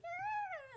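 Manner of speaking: very high, loud held squeal; high-pitched mock fangirl scream; mock girlish squeal. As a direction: imitate swooning girls with a long squeal. Imitates girls reacting to shirtless boy.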